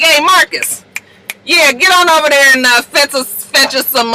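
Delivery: imitated calling over. Fitz sends Marcus for a drink, calling out. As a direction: call him over like the help.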